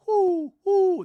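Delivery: loud, high-pitched owl hoots; big imitation owl call. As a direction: hoot loudly like an owl up high. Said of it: "We see an owl go".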